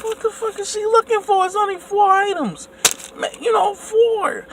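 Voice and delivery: high mock exasperated thought voice; inner voice. His silent thoughts at the cashier, high exasperated. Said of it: "Inside my own mind".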